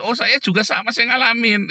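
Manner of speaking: lightly hammed-up zealous testimony; eager churchgoer. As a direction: imitate a keen believer jumping in. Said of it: "Ngomongnya tentang Tuhan".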